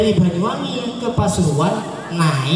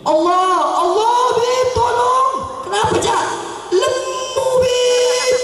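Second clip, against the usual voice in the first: shocked friend. Acted. Cak Rijal shrieking at the wife's photo. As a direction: wail in comic horror.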